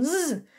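"Z" held as a voiced zzz; buzzed Z sound. Shown as a demonstration. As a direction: buzz "Z", not the letter name. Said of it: "que mon S chante". (« Z » bourdonné : zzz)

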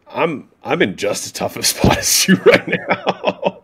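slow, laughing through the words. Real, not acted. Telling the desperate gym owner about his own trouble, laughing. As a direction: chuckle at how bad it is. Laughing at shared bad luck.

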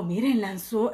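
ordinary delivery

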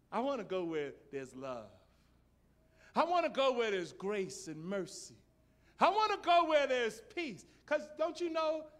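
from level to loud and high; building preaching cry. Real, not acted.

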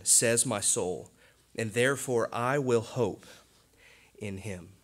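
loud, ringing Bible reading; scripture reading. Acted. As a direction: proclaim the verses to the congregation. Reads scripture aloud.

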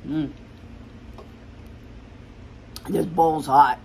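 talking while chewing food; eating pasta between words; mouth full, chewing. Real, not acted. Seen 1: Chews after bite through about 5 s, then talks holding bowl.

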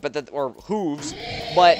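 ordinary talk, nothing marked

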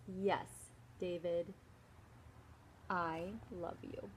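soft, slow loving words; tender mom. Acted. Mom forgiving David, soft and tender. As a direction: hold him close with your voice.

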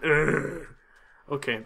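ordinary delivery

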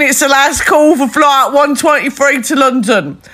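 loud announcer voice; airport announcement. Pretend. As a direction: boom it like a gate call. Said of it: "attention all passengers".

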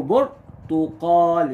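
برتقال broken slowly as bur, tu-qaal; split syllables. Shown as a demonstration. Teacher showing pupils the silent-vowel ra, split syllables. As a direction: pause after the بر in برتقال. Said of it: "مش هنقول".

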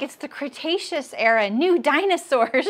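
imitating mom's eager delight; mom excited. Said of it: "She got so excited".

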